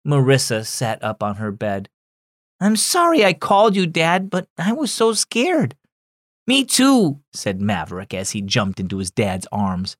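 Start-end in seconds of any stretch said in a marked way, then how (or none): 2.6-5.8 put-on high, girlish voice; apologetic girl. Daughter says sorry to her dad, high apologetic voice. 6.5-7.2 put-on high, childlike voice; little boy. Five-year-old jumps into his dad's arms, high little-boy voice.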